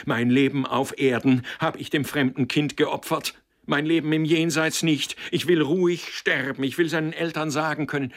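high, loud frantic outburst; out of control. A narrator's reading. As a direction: cry it out, losing all restraint. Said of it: "alle Herrschaft über sich verloren".

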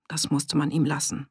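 ordinary delivery, nothing marked

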